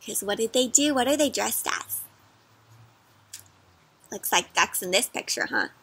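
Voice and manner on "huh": ordinary delivery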